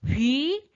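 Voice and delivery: 휙 sweeping up from mid to high; second tone. Shown as a demonstration. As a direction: let 휙 shoot upward like a rising question. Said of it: "이성으로 읽어주시는 거예요". (휙을 위로 확 올리며, 이성으로)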